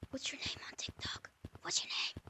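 hushed unvoiced whisper, quick. Real, not acted. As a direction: whisper it so the mic won't catch it. Asking name privately during recording.

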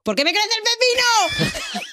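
high, slow comic yell; a hammed-up cry of a silly punchline; shouted punchline.

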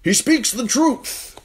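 high, loud, slow urgent plea; urgent shout. Acted. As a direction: cry out the truth urgently. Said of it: "an urgent voice called out".

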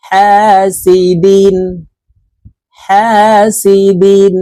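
হাসিদিন drawn out slowly, vowels held; slow recitation. Shown as a demonstration. Teacher chanting হাসিদিন for the class. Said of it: "দাল দুই জের দিন".

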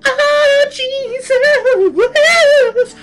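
very high, loud hammed-up diva wail; mock belting singer. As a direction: parody an over-the-top church soloist belting it out. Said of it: "trying to belt that shit out".

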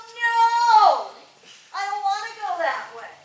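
high, loud, stubborn refusal. Retold or imitated. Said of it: "being stubborn is not".

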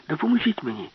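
high, urgent call across the hall; cry for help. Acted. Alice calls the professor for help, high urgent cry.

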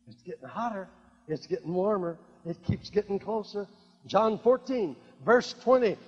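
usual speaking voice

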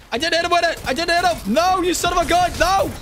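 high, loud protests as he's chased down; frantic shouting. Real, not acted. Streamer being chased by an enemy, frantic shouting. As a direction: yell in real panic.

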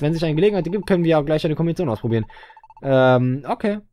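ordinary talk

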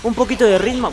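excited. Real, not acted. Streamer raving about the game's music, excited.

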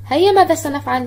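ordinary talk

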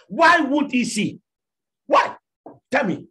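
high, loud, slow challenge; angry demand. Real, not acted. Preacher rebuking women in the church, angry demand. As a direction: rebuke them sharply, demanding answers.

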